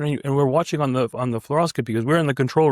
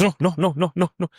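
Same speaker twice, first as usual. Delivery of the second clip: put-on rapid refusals in a higher Indian-accented voice; 4 semitones higher than usual. Mentor rejecting the trainee's plan, Indian accent. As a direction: imitate an Indian mentor waving it away. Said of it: "In, in his Indian accent".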